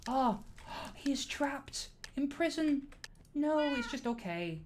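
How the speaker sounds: high, slow, drawn-out lament; gasp, then stretch it out high; mock dismay. Streamer reacting to a trapped figure, mock-dramatic dismay.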